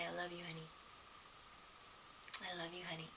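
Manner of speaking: very soft, rote husband's drone; softly droning the same words every night. Retold or imitated. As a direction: mumble it rote, half asleep. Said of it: "her husband would roll over and say".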